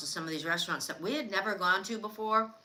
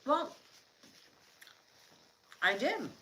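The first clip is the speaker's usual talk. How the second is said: talking while chewing; mouth full; 4 semitones higher, slower than usual. Real, not acted. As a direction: answer through a mouthful of food. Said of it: "I'm chewing".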